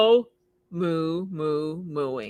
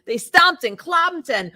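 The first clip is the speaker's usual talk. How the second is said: loud, high, slow romping read; excited stomping; 11 semitones higher, 12 dB louder than usual. A narrator's reading. The cows stomping with excitement, loud and high. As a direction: stomps the words out with glee.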